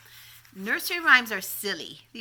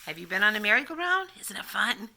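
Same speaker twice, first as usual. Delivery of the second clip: usual speaking voice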